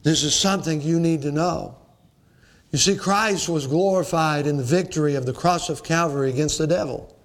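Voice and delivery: normal talking voice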